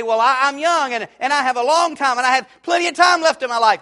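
mocking young skeptic. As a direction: throw out the careless excuse loudly, like a brash young man. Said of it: "You say".

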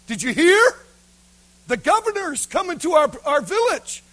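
high, loud excited villager; spreading news. Acted. Villager telling another the governor is coming, excited. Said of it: "And the word got out".

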